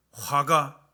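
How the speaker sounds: ordinary talk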